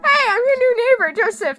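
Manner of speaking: cheery neighbor voice. Acted. Joseph re-enters cheerfully.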